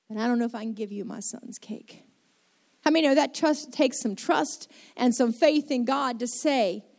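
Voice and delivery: usual speaking voice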